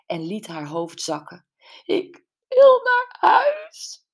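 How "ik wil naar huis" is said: very high, slow, loud wail; whimpering. A narrator's reading. Gwen crouched against the wall wailing to Brenda. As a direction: wail it like a lost child. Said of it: "jammerde ze".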